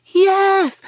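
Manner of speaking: put-on high, loud, drawn-out little-kid yell; imitated shrieking child's cheer; screaming child. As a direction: holler it like an excited toddler. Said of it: "because she's screaming".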